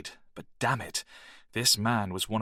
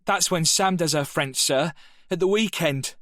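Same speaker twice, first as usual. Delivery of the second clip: a louder, much higher jeering call; shouted taunt; 8 semitones higher, 4 dB louder than usual. Acted. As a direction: shout the joke across the classroom. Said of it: "shouted Danny".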